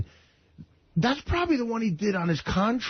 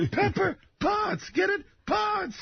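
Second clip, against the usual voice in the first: mock call-out; slower than usual. Punning on the name, calling out.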